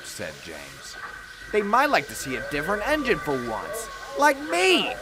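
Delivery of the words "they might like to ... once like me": self-important. A narrator's reading. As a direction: show off, pitch climbing to the finish. James boasts, chuffs away importantly.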